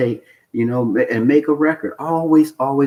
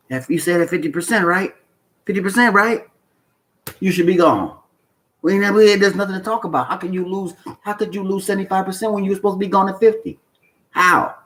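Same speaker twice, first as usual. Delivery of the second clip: exasperated scolding; 7 semitones higher than usual. Real, not acted. Scolding traders who break rules.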